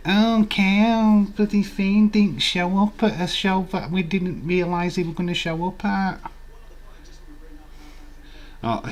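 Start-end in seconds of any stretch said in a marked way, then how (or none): 0.1-6.2 higher, louder mock complaint, imitating a crying fan; mock whining fan. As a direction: whine like a sulking fan. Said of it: "you all start flipping crying".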